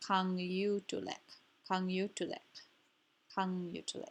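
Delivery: kang yu tu lik with kang and lik stressed, syllables spaced out. Shown as a demonstration. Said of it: "kang and lik will be stressed".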